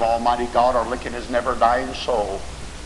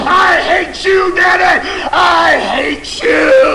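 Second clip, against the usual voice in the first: high screamed accusations, building in volume; furious accusing son; 9 dB louder, slower than usual. Acted. Damned son blaming his daddy, furious screaming. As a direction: scream hatred at your father, dragging out "I hate you". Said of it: "and he's saying".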